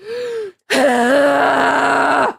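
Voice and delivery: frustrated groan. Real, not acted. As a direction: groan it out at length, fed up. Frustrated groan during angry rant.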